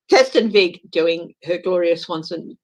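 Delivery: normal talking voice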